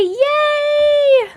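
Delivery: high, loud happy cheer; excited yay. Real, not acted. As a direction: cheer for the fan's new order. Viewer ordered merch, cheering.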